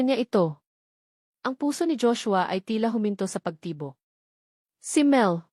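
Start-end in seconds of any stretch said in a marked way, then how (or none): none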